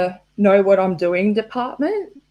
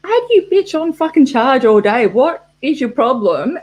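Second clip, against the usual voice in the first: mock rant at laptop; 4 semitones higher than usual. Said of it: "And I'm like".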